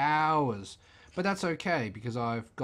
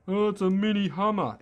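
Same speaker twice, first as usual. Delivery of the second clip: higher, louder gush of a naive fan; mock gushing onlooker; 7 semitones higher, 5 dB louder than usual. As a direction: ham up a clueless fan calling it a Hummer. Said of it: "What do you think?".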